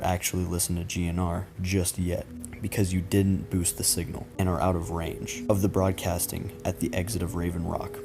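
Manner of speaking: usual speaking voice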